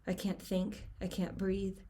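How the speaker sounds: usual speaking voice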